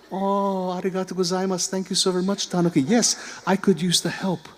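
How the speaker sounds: put-on sweet high old lady, grateful; grandmother voice. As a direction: thank him like a kindly granny.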